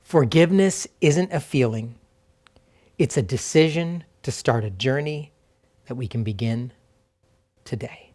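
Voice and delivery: spacious reading with long pauses between phrases; slow reflective reading. Real, not acted. Pastor giving listeners time to remember each truth, slow and reflective. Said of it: "I'm just gonna read them slowly".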